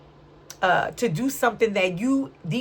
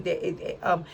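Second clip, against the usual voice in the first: usual speaking voice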